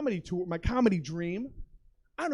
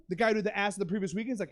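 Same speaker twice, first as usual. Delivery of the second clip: usual speaking voice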